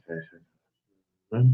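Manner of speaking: ordinary talk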